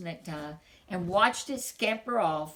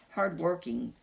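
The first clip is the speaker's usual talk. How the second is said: ordinary delivery, nothing marked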